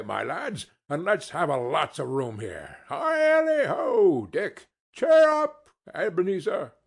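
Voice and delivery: hearty high cheerful shouts; rally the apprentices; jovial Fezziwig call. A narrator's reading. Fezziwig calling out.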